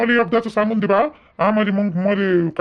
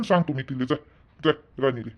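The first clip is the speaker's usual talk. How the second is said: quiet shooing; 9 semitones lower, 7 dB quieter than usual. Acted. Son shooing mother out quietly.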